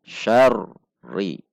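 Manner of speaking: "Syarri" dipisah: "Syar", jeda, "ri"; dipisahkan. Shown as a demonstration. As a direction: tahan sejenak setelah "Syar" lalu lanjut "ri". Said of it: "kalau dipisahkan seperti itu". (r "Syarri" seakan berhenti)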